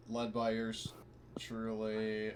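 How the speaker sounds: ordinary delivery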